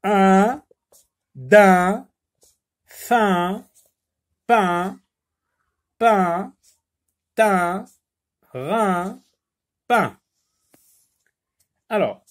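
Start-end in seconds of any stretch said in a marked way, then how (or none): 0.0-10.2 loud, higher, each word alone with pauses; reading a word list to the class. Shown as a demonstration. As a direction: call out each one-syllable word slowly. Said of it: "Lis des mots d'une seule syllabe".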